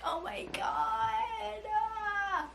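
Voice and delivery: slow, high tearful cry; really tearful gush; emotional. Real, not acted. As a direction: let the emotion crack through. Moved to tears.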